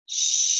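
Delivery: steady voiceless shh; sprinkler hiss. Retold or imitated. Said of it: "a steady sprinkling sound like this".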